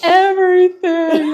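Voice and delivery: loud, slow, high wail of pain; mock wail. Friend mimics the fallen rider answering what hurts, wailing. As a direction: imitate a hurt friend hollering back.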